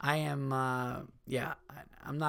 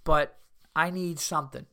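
high mock Minnesota dealer; chirpy Midwest twang, pitched up; imitating a Minnesota salesman; Minnesota accent; 4 semitones higher than usual. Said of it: "it'd be more like".

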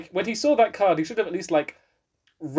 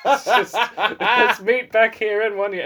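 higher, louder, slow words through laughter; laughing; 6 semitones higher, 5 dB louder, slower than usual. Real, not acted. Joking about a romantic film reunion, laughing. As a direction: laugh your way through the mock arrangement.

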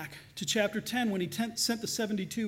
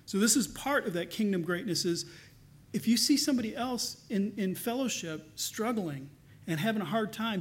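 ordinary delivery

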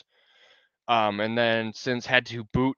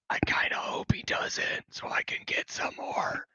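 hushed conspiratorial whisper; 5 dB quieter than usual. Real, not acted. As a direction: whisper it like a secret aside. Sly aside hoping player cools off.

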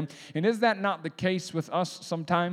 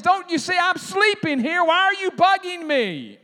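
much higher, loud, shouted complaint; grouchy woken Jesus; 12 semitones higher, 7 dB louder than usual. Pretend. Woken Jesus shouting at the disciples who bugged him, mock grouchy. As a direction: yell like someone dragged out of a good nap. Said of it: "Probably what he won't say is".

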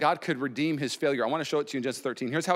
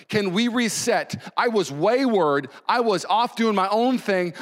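preacher voicing a pleading prayer; repentant Abraham; 4 semitones higher, 3 dB louder than usual. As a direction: cry out in fervent repentance. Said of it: "This is Abraham repenting".

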